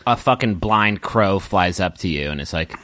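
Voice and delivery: normal talking voice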